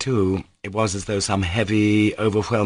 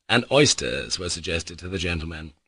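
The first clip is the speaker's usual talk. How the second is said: ordinary delivery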